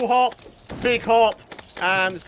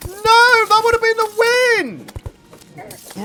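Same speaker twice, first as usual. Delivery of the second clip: high loud cry of frustration; real gamer despair shout; anguished shout; 12 semitones higher, 8 dB louder than usual. Real, not acted. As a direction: wail at the missed win. Choked the win.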